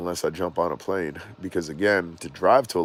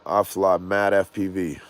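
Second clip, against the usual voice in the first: usual speaking voice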